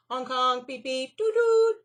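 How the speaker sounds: loud, high honking horn noises; car horns. Acted. Narrator voicing the honking city traffic.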